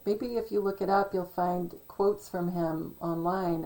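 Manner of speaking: normal talking voice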